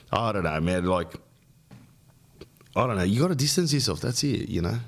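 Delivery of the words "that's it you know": normal talking voice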